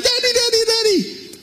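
very high mock child's squeal; crying out. Us in the storm calling to daddy, high crying out. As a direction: imitate a panicky child calling for help. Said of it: "now crying out".

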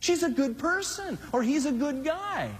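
high, pleading defence of a partner; mock a churchgoer defending their sweetheart; imitated congregant whining that she loves me; imitating a smitten believer. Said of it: "You say".

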